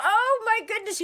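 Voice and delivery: excited. Real, not acted. Excited reaction to news.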